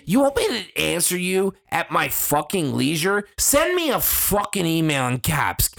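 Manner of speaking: usual speaking voice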